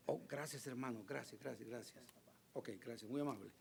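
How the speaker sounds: low, hushed thanks off to the side; quiet aside. Real, not acted. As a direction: drop out of preaching to thank someone privately. Thanks someone aside mid-sermon.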